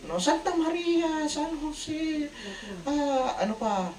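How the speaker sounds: high, slow calling of saints one by one. Retold or imitated. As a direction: plead to each saint as the ship sinks. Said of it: "kung sino-sino ng santo ang tinawag".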